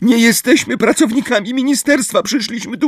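high, breathless pleading; Hermiona. A narrator's reading. Hermione begs centaurs not to attack, breathless. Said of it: "której wyraźnie brakło tchu".

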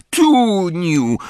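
high, loud call after someone running off; a girl calling after her crying sister. A narrator's reading. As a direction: shout her name as she runs away. Calls after fleeing sister.

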